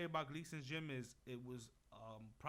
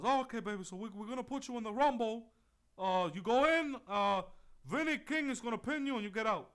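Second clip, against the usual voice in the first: mocking impression of Johnny; 7 semitones higher, 10 dB louder than usual. Imitating the promoter Johnny rejecting him, high loud mockery. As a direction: ham up the boss brushing him off. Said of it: "he goes".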